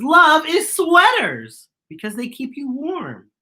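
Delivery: put-on high, bright little child voice; a small child sharing an idea; child's voice. A narrator's reading. Teacher reading to class, voicing a child. Child's line in read-aloud.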